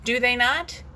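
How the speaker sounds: ordinary talk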